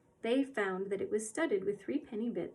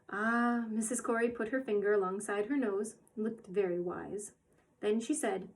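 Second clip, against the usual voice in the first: plain reading voice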